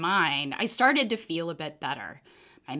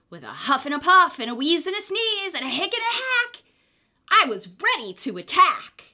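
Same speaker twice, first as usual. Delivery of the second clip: louder, higher, building to the attack; huffing and puffing; 7 semitones higher, 5 dB louder than usual. A narrator's reading. Exhausted wolf gathers everything for the brick house, building. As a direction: work up a giant breath.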